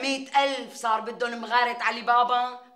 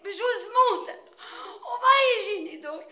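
fake crying; 6 semitones higher, 4 dB louder than usual.

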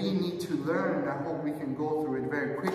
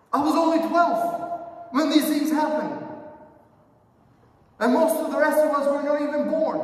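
loud, high, indignant outcry; agitated protest; 9 semitones higher, 8 dB louder than usual. Pretend. Young listener shouting back at Moses in protest. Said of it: "I would be agitated".

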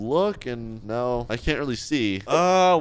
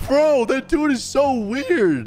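high, drawn-out cry of disbelief; creeped-out outcry; 10 semitones higher, 3 dB louder, slower than usual. Real, not acted. Player to viewers about a creepy doctor, creeped-out outcry. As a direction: stretch it out high in appalled protest.

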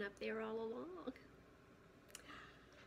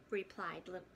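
ordinary delivery

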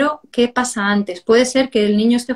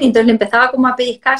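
normal talking voice

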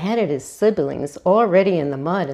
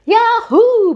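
much higher and louder whoop; gleeful shout; 8 dB louder than usual. A narrator's reading. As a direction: whoop while sliding through the mud. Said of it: "Buck shouted".

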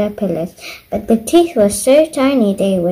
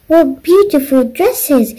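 higher, louder delighted cry; exclaiming; 6 semitones higher, 3 dB louder than usual. A narrator's reading. Twinkle admiring the fairy dresses, exclaiming with delight. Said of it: "exclaimed Twinkle".

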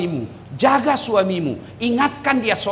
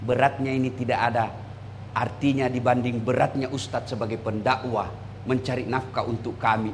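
soft wife's words; 10 semitones lower, 7 dB quieter than usual. Retold or imitated. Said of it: "Apa nak bilang?".

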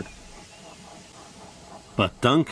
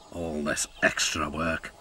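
grumbling; 6 semitones lower, 5 dB quieter than usual. Acted. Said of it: "grumbled Duncan".

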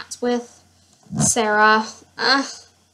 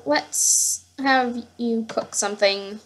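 ordinary delivery

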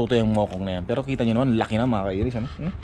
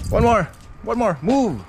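excited urging; 10 semitones higher than usual. Real, not acted. Urging the dog.

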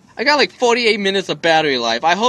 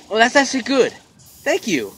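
normal talking voice

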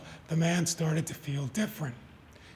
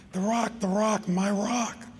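higher-pitched, insistent repetition; a restrained scream, pitched up and urgent; mild narrated scream; 4 semitones higher than usual. A narrator's reading. Man frantic for his lost rock, mild scream. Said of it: "screamed the man".

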